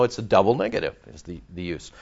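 usual speaking voice

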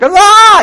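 short, high, loud mock scream of pain; a movie Jesus yelling in agony, imitated; mocking how films show the cry, short high scream; imitated anguished cry. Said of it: "cried out, you know, we think".

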